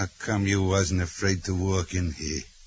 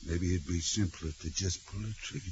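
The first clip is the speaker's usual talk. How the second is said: softer, low threat; quiet menace; 4 semitones lower, 9 dB quieter than usual. Acted. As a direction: murmur the threat coldly. Ringleader threatening the negotiator.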